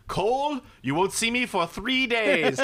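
high, slow, hammed-up declaration; mock grand announcement. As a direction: announce your retreat like a tycoon. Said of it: "It would be like".

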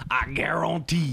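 slow, drawn-out mock Cajun French accent; Louisiana Creole accent. Shown as a demonstration. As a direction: show off a Creole twang in Louisiana style. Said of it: "that's my Creole".

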